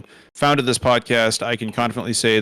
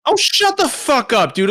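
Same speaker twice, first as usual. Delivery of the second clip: mock outraged yell; 12 semitones higher than usual. Mock outrage at hair complaint.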